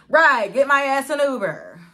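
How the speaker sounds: loud, high, indignant agreement; exasperated outburst. Real, not acted. Mother left without her car tells followers, exasperated outburst. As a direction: belt it out, fed up.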